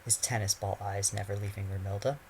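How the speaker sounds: ordinary delivery, nothing marked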